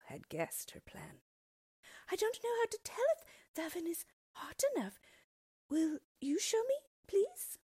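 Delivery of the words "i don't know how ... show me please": put-on high, small child's voice, pleading; innocent little girl. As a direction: play dumb sweetly to trick her. Gretel feigns ignorance.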